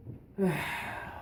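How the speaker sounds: long breathy sigh of giving in; resigned sigh. Acted. Grandfather giving in to grandson, resigned sigh.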